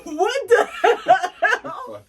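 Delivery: drawn-out, high, loud what the hell; baffled surprise. Retold or imitated. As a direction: stretch it out in disbelief. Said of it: "thinking to myself".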